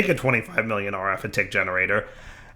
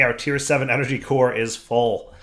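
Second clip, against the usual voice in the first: ordinary talk, nothing marked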